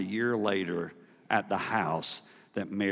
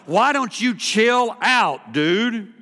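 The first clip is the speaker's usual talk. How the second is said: scoffing onlookers; 11 semitones higher, 10 dB louder, much slower than usual. Retold or imitated. People scoffing at Simeon.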